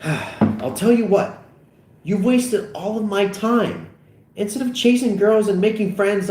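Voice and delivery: high, resentful outburst; heated, emphatic blame; bitter tirade. Acted. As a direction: let the resentment pour out, high. Acted quarrel, bitter tirade.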